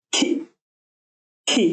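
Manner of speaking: isolated খি said slowly and clearly; demo sound. Shown as a demonstration. Teacher demonstrating খি to students, clearly enunciated. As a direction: sound out খি as a single syllable. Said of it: "এটা C এর খি আর খে এর খি".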